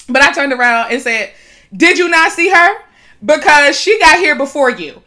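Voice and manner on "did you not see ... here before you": loud and high, finger up in the air; telling off a man in line, loud; loud retold call-out. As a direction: call him out across the store.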